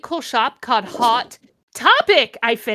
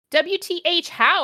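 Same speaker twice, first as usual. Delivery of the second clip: mild mock scream; 8 semitones higher, 4 dB louder than usual. A narrator's reading. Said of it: "I screamed".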